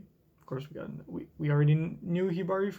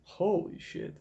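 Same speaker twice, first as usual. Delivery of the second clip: usual speaking voice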